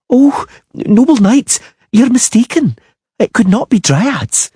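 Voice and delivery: put-on scared girl pleading with the knights; frightened little girl. A narrator's reading. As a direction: squeak it out like a scared child. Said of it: "What are you squeaking about".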